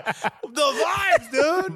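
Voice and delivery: excited shout. Real, not acted. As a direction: yell it out, carried away by the story. Excited retelling of goalkeeper.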